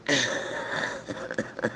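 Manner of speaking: soft, voiceless wheezing laugh; real laugh. Real, not acted. Owner recalling the bird's cursing, laughing. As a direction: laugh until the voice drops out.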